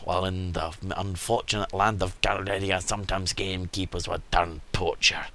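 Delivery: lightly voiced Scottish merchant; a hint of a Scottish storyteller; put-on Scottish accent. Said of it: "He's now Scottish".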